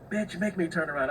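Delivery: high-pitched imitation of a confrontation; angry retort. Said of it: "jumped up straight to a nine or ten".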